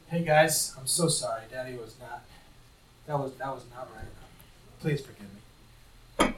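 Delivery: put-on father quietly apologizing to his kids; soft apology. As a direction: humbly ask the kids for forgiveness.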